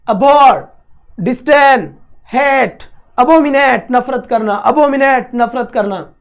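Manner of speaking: words called out loud for the class to repeat; loud drill. Shown as a demonstration. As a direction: shout each word so they repeat it. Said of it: "जोर जोर से बोलो word को". (ज़ोर ज़ोर से शब्द बोलना)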